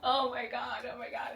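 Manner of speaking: breathless, teary oh my god, pitch up high; overwhelmed. Real, not acted. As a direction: gush at the book, half crying. Overwhelmed by the book.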